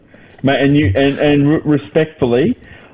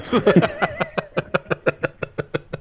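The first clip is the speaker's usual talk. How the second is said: wheezy laugh; 12 semitones higher, 6 dB quieter than usual. Real, not acted. Laughing after the joke.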